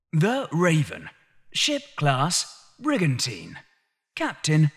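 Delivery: high, soft game status readout; system readout voice. Acted. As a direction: read it like a game interface. Analyse readout of enemy ship.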